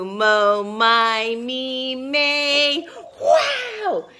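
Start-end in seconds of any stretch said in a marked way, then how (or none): 3.2-4.1 high laughing wow; delighted wow. Real, not acted. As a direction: marvel at them. Praise after chant.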